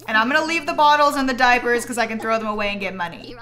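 ordinary talk, nothing marked

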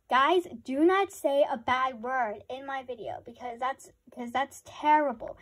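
loud, emphatic telling-off; scolding. Real, not acted. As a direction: warn the viewers loudly and firmly. Scolds viewers about bad words.

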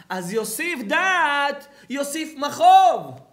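slow, loud, drawn-out proclamation; preaching at full force. Real, not acted. Rabbi thundering Solomon's verse at his audience, slow and loud. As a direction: thunder the verse out slowly, word by word.

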